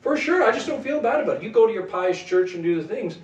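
high, dragged-out mocking voice of someone who shrugs off sin; dismissive unbeliever. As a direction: play the smug skeptic brushing him off. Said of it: "I want to say".

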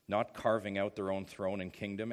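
normal talking voice

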